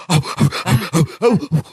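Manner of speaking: short huffy "oh" breaths; imitated hyperventilating; mock panicked panting. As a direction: puff out quick panicky ohs. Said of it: "Larry starts to panic".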